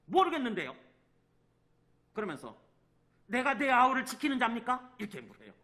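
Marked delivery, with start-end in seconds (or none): 0.1-0.7 brazen denial, higher and louder; acted shameless know-nothing reply. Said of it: "뻔뻔스러운 자인가". 3.3-4.9 loud, high-pitched retort; acted defiant question thrown back; arrogant, brazen. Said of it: "얼마나 교만하며".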